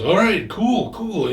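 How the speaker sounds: high, eager agreement; excited. Retold or imitated. Pal agrees to hand over the jackets, excited. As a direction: pitch jumps up, delighted. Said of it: "he's all excited".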